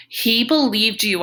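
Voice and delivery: acted accusation. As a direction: throw it at the doctor as a pained accusation.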